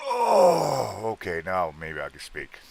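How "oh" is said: surprised. Real, not acted. Sudden reaction in chat.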